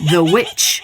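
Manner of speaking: high wicked cackle; evil cackle. Acted. Witch cackles at the prince, high and wicked.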